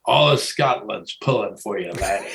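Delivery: low, lightly voiced Scottish caddy; a hint of a Scottish brogue, dropping low.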